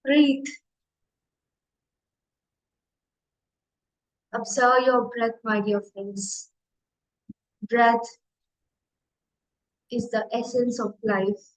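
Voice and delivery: measured meditation guide with long silences between phrases; unhurried meditation leading, spacious pauses; guided meditation. Real, not acted. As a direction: guide listeners gently into stillness, one short phrase at a time.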